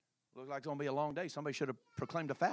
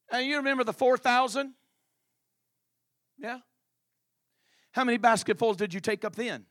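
loud, higher pressing questions; 8 semitones higher, 13 dB louder than usual. Acted. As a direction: press them hard for the answer. Jesus pressing disciples.

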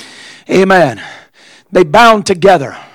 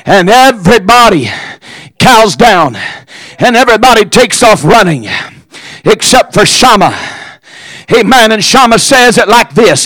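forceful preaching; 3 semitones higher than usual. Real, not acted. Preacher builds up Shammah's stand for the congregation, forceful preaching. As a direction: drive each phrase out, then pause.

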